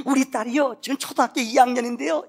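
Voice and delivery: excited bragging. Acted. Mother boasts to the pastor about her daughter, excited. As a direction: brag about her child with growing delight. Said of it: "막 신이 나는 거예요".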